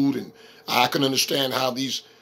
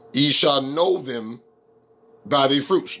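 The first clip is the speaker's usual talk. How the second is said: slow, deliberate scripture reading with a pause midway; slower reading; slower than usual. Shown as a demonstration. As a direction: read it slower, pausing after "them". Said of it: "let me read it slower".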